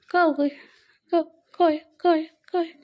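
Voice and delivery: cooing at the little one. Real, not acted. Playing with the baby.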